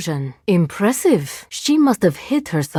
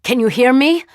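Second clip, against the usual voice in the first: calling out; 7 semitones higher, 4 dB louder than usual. Acted. Calling out to the Curator.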